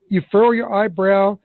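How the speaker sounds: ordinary talk, nothing marked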